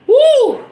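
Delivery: high, sudden whoop to let emotion out; real high-pitched release whoop; emotional woo. Real, not acted. Releasing emotion as she talks.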